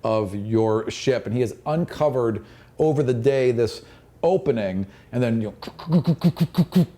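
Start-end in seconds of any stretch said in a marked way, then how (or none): none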